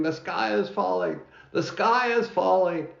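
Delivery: high, hammed-up alarm cry; mock yell of alarm, pitched high; panicked yelling. A narrator's reading. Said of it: "running around the barnyard yelling".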